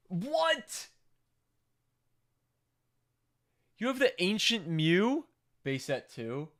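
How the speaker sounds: high, loud, amazed disbelief; shocked surprise. Real, not acted. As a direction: gasp it out in real shock. Reacting to rare card.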